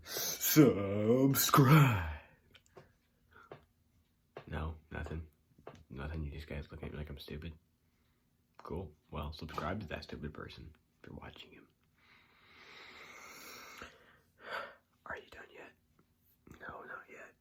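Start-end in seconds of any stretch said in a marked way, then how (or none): 0.0-2.0 loud, high, far-off game announcer boom; Mortal Kombat announcer. Acted. Hamming up subscribe like a Mortal Kombat announcer. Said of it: "It's like a Mortal Kombat character". 9.1-17.3 whisper. Acted. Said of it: "whispering asides to viewer".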